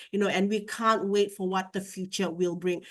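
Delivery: ordinary delivery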